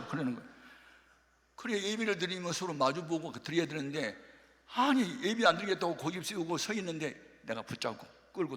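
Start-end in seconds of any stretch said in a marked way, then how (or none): none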